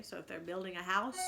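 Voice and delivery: usual speaking voice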